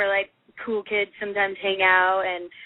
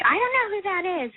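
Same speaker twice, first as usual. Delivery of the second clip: high, quick mock exasperation; exasperated friend; 9 semitones higher, faster than usual. Best friend answering an excited fan, exasperated. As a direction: imitate a clueless friend, pitched up and hurried.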